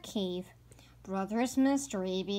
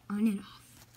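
whispered, barely there. A narrator's reading. Narrator whispering about the lightning bugs. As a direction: hush for the softest sound.

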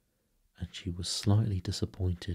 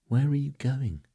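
high, louder, worried cry; crying with misgiving; 8 semitones higher, 5 dB louder than usual. A narrator's reading. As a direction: call out in alarm, pitched up. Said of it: "she cried with misgiving".

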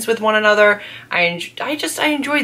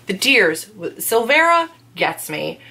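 normal talking voice

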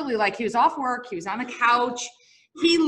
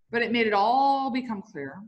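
usual speaking voice

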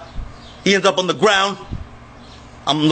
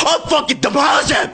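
furious shout; 4 semitones higher than usual. Real, not acted. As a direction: yell it at them with everything. Screaming at dad then mom.

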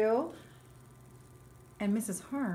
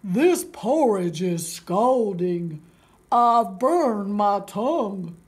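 put-on big old bear, loud and slow; Papa Bear; 12 dB louder, much slower than usual. Papa Bear scalded by porridge, loud slow bellow. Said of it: "cried the big old Papa Bear".

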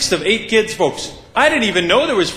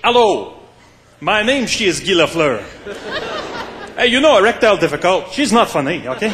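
Guy Lafleur. Acted. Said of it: "there's, there's Guy".

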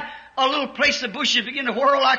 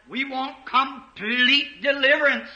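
normal talking voice

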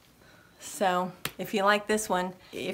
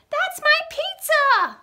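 put-on very high, loud girl's yell; shrill-pitched indignant girl yell; 5 dB louder than usual. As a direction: squeal it like an outraged little girl. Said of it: "A girl yelled".

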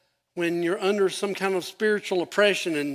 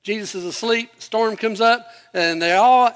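ordinary delivery

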